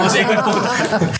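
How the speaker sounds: laughing. Real, not acted. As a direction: laugh while saying it. Joking insult repeated. (diciéndolo entre risas)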